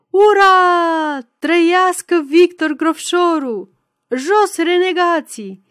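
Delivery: loud, high, drawn-out hurrah; full-voiced political rally chant; crowd cheering. A narrator's reading. Crowd surging to see the candidate, loud cheering.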